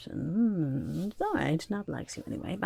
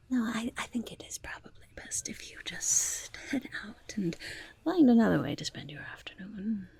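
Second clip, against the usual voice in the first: ordinary talk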